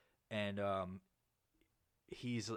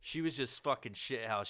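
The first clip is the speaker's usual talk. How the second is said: ordinary talk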